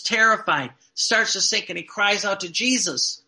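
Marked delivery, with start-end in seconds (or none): none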